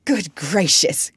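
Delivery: slow, mildly startled doctor; startled exclamation. A narrator's reading. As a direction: exclaim in mild surprise, drawn out. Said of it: "cried the doctor".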